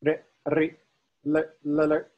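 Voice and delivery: chanted "re" and "le" in short and longer forms; level-pitched syllables, tongue touching and releasing; touch-release tones. Shown as a demonstration. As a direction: model "re" and "le" for students to repeat. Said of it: "I'll do all four".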